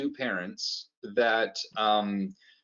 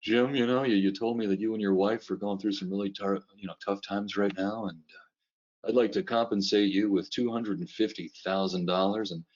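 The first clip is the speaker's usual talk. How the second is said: low, earnest reality-show boss; hammed-up heartfelt generous boss; heartfelt American boss; 3 semitones lower than usual. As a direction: lay on the sincerity like a TV boss. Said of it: "when you watch the American one".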